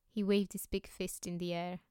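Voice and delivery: ordinary delivery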